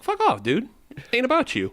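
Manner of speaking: rude goat voice. Pretend.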